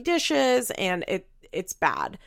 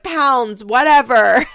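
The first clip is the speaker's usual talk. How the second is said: dismissive; 5 semitones higher, 11 dB louder, much slower than usual. Retold or imitated. Barbara corrects herself dismissively.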